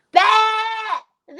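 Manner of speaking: put-on high bleating goat kid; baby goat bleat. Shown as a demonstration. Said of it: "That's baby goat".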